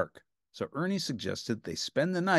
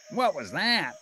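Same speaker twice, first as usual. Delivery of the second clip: Bert shrieking; 6 semitones higher than usual. A narrator's reading. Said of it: "shrieked Bert".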